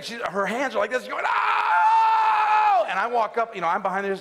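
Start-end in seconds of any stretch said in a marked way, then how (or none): 1.3-2.9 loud, very high imitated baby scream; long piercing mock wail; newborn's scream. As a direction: scream like a newborn in full cry. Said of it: "she's screaming".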